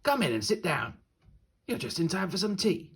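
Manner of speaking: high, friendly wolf host voice. Acted. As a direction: welcome the child like a kindly wolf host. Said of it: "said the last wolf".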